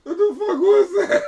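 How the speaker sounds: high-pitched, loud shriek of alarm; startled yell. Real, not acted. Asking co-host what just happened, startled shriek. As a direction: cry out in real shock, voice shooting up.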